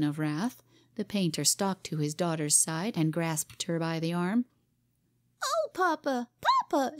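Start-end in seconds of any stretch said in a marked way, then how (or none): 5.4-7.0 put-on a much higher wailing little girl; child's scream. A narrator's reading. Cora crying to her father, high child's wail. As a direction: cry out like a pinched child. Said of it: "she screamed".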